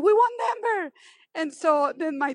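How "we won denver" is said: high-pitched joyful cry of victory; retold excited scream. Wife running to tell husband they won, excited high cry. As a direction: shriek the news to your husband, thrilled. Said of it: "I start screaming".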